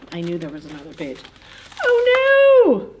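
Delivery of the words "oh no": high squeak dropping to a worried no; tiny bug gasping in alarm; alarmed ladybug. A narrator's reading. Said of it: "said the ladybug".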